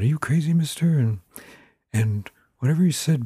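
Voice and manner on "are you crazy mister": put-on higher, curious little kid; child's voice. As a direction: ask it like a nosy small child. Narrator voices small child.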